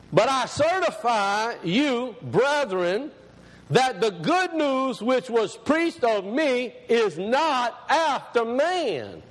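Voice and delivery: very slow, deliberate verse reading; measured, stretched-out Bible reading; scripture reading. Acted. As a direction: proclaim each phrase, drawing out the key words. Reads scripture aloud to congregation.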